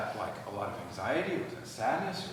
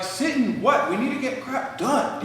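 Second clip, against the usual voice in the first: much higher and louder, exasperated wife; hammed-up, high, loud impatient spouse; mock impatient wife; 13 semitones higher, 10 dB louder than usual. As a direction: play the busy wife brushing him off. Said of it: "she's like".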